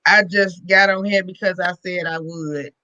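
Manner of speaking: low, slow, weary voice; tired. Real, not acted. Guest telling hosts why she is leaving early, tired. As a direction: drag the words out, drained and ready for bed. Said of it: "kinda tired today".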